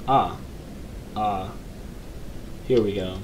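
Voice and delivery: ordinary talk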